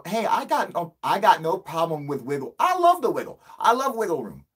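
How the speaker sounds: high, loud, over-insistent defence; mock protest. Caller defends himself to interviewers, high mock protest. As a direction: protest too much, voice climbing high.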